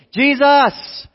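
loud, high cry for attention; full-voiced pleading shout; yelling. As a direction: yell the name across a village. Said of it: "They're, like, yelling".

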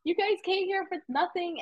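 high, teary voice, choked with pride; crying while telling clients you're proud of them; tearful praise. Retold or imitated. Speaker retelling crying to clients from Zacatecas, high and tearful. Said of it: "I'm literally crying because I'm like".

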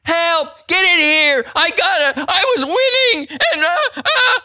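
put-on high-pitched, whining gamer-kid wail; mock kid screaming. Preacher imitates kids whose Xbox turned off, mock screaming. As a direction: imitate a kid screaming over a lost game. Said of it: "they're screaming".

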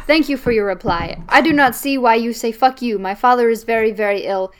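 normal talking voice

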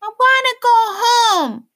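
put-on high, loud little girl wailing; crying child. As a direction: wail it like a scared toddler. Small child Poppy wants home.

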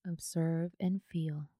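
unhurried yoga nidra guidance, leaving space after each phrase; guided meditation. Acted.

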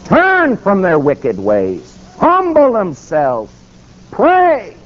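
slow, drawn-out high cries; preaching exhortation. Real, not acted. Preacher calling God's people to repent, ringing exhortation. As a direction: hold each command long, pitch climbing to "Pray". Preaches scripture commands to congregation.

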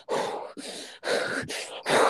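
long voiceless sigh of breath; mock heavy breathing. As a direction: breathe out hard, fuming. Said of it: "I'm just breathing hard and shit".

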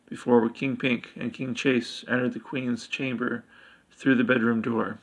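plain reading voice

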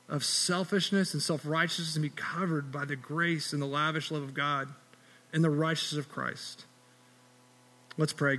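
ordinary delivery

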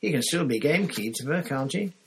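put-on high, dismissive girl's retort; hard voice. A narrator's reading. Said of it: "said Parvati in a hard voice".

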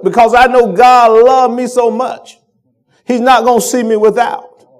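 full-force preaching. Acted. Pastor assuring the church God provides, full-force preaching. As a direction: preach it hard, calling out the church.

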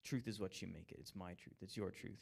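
fading, dismissive relativist; mocking the world's my-truth talk; mock worldly voice. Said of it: "the rest of the world says".

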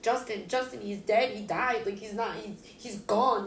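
high, urgent retelling of shock; retold panic. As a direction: frantically insist that he is gone. Panicked retelling of telling mom.

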